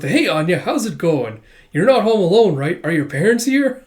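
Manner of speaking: acted overeager visitor; excited barging-in. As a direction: burst in full of nosy excitement. Excited friend bursts through door.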